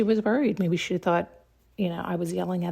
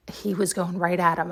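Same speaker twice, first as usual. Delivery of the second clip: ordinary talk, nothing marked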